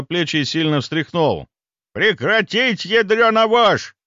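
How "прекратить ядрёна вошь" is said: high, loud furious bellow; рёв. A narrator's reading. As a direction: roar it in her face. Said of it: "взревел он ей в лицо". (яростный рёв в лицо)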